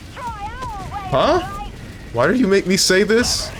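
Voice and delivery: high-pitched, incredulous complaint; mock-indignant protest. Real, not acted. Streamer to a chatter who made him read that, high-pitched indignation. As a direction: squeal it in comic disbelief.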